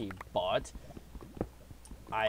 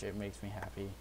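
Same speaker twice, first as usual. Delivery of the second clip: normal talking voice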